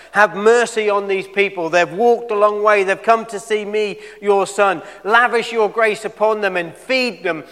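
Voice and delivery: loud, high, imploring mock prayer; imitated begging prayer. Jesus imagined begging his Father for the hungry crowd, fervent pleading. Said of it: "begged his Father".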